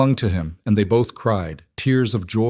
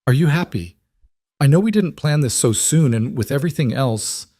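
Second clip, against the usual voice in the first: higher, hopeful and afraid; tremulous with hope and fear; 6 semitones higher than usual. A narrator's reading. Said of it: "Her voice was tremulous with hope and fear".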